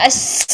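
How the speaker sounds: "اس" with a long thin hiss like s; over-tight sheen. Shown as a demonstration. Tajweed teacher showing sheen stuck too tight, hissed. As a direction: press tongue to palate, hissing "اس". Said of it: "بالکل اگر چپکا لیں گے".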